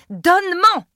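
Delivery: high, loud demand; strained, urgent shouted order. A narrator's reading. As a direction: insist on it right now. Woman in labour demands potion.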